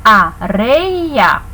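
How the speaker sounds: "Areia" drawn out, with "rei" held together as one beat; syllable by syllable. Shown as a demonstration. Teacher showing that diphthongs stay together, syllable by syllable. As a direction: sound out "Areia" in slow syllables, diphthong unbroken. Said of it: "também não são separados".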